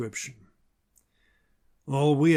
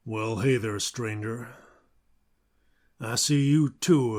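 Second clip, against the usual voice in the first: cowboy narrator ad; 2 semitones lower than usual. Acted. Host in a Western storyteller voice reading the Patreon ad. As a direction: spin a lone-wanderer tale as a frontier local.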